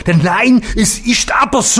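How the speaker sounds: vehement Baden-Württemberg politician; mock Swabian politician. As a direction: ham up a vehement Kretschmann. Mocks vehement Kretschmann-style guest.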